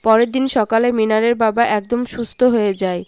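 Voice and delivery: low, even storytelling voice; low-pitched storyteller; story narration. A narrator's reading. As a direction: narrate it evenly in a low register.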